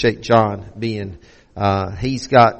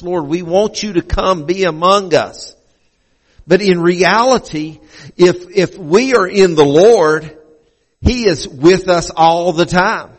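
higher, louder preaching voice; 7 semitones higher, 5 dB louder than usual. Real, not acted. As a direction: preach it out with fervor. Pastor preaching at full force.

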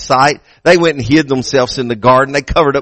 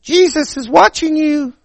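high squawky parrot; 12 semitones higher than usual. Acted. Parrot warning the robber in a high squawk. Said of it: "that parrot said".

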